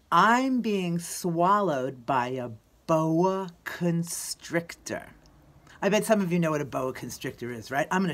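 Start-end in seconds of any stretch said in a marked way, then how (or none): 0.1-5.1 slow, drawn-out song title; slow announcement. Real, not acted. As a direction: announce it slowly, word by word.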